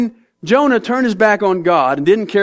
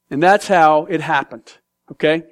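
usual speaking voice

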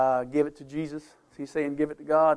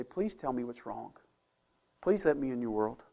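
tender plea; 4 semitones lower, 6 dB quieter than usual. Retold or imitated. Voicing a caring friend.

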